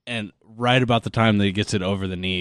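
ordinary talk

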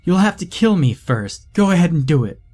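loud and high, daring him to swing; defiant shout. Acted. Son shouts back at his drunken father, defiant. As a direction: yell it right in his face.

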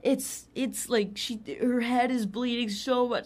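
high, stammered, frantic report; loud, dramatic retold alarm; imitated freaking out. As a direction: mimic a panicked friend on the phone. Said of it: "him freaking out".

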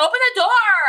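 high, loud yell to open the door; shouted demand. Retold or imitated. Puppy yelling at Eric to open the car door, loud high shout. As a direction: bark it at him from outside the car.

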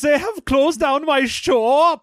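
high, slow, lamenting German Santa; hammed-up thick German accent. German Santa on his closed shop, mock-woeful German accent. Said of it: "got a thick German accent".

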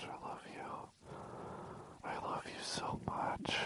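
breathy whisper, slow and intimate; hammed-up whispered adoration; whispered mock love. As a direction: breathe it out like a secret crush. Mock gushing ends in whisper.

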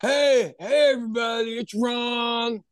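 high, shouted mock podcast host greeting; Ron's podcast intro. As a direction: ham up a small-time host welcoming nobody. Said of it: "he had a podcast that nobody listened to".

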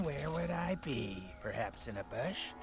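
soft, low musing question; hushed guess. Acted. Searcher wondering if the demon is in a bush, hushed. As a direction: wonder it quietly to yourself, low.

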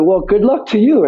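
high mock Cockney send-off; Jason Statham. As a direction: imitate a Cockney mate wishing luck. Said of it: "you know, Cockney".

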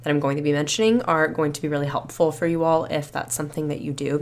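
ordinary delivery

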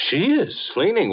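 leaps up high in disbelief; genuinely taken aback; surprised. Acted. Archie hears the blonde cleans.

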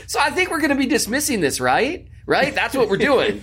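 high, fast and cocky; smug mock demand. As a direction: lean back and push them cheerfully. Feet on desk, smug demand.

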